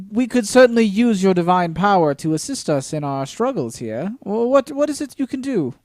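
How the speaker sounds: flustered party member. Acted. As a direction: humour a self-styled god with uneasy politeness.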